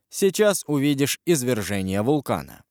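usual speaking voice

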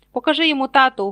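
rooting loudly from the crowd; excited shout. A narrator's reading. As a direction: yell it out as encouragement. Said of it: "гукнули Фред і Джордж".

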